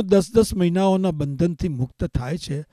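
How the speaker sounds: usual speaking voice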